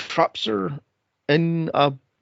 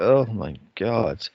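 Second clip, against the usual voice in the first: slow exasperated groan; 7 semitones lower, slower than usual. Real, not acted.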